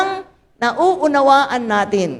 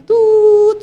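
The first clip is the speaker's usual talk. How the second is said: high, loud imitated monitor beep; flatline beep; 11 semitones higher, 7 dB louder than usual. Widow recalling husband's monitor, loud beep. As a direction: sound out the heart monitor flatlining. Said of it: "biglang nag-flatline".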